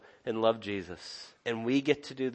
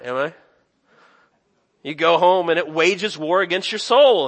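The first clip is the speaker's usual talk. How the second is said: higher and louder, pressing the point home; preaching at full force; 5 semitones higher, 7 dB louder than usual. Acted. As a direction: preach it hard and personal. Preacher's rising passage.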